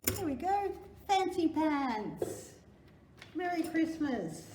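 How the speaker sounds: beaming sing-song presentation; delighted reveal. Pretend. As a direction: show off the fancy pants with glee. Reveal of Christmas undies.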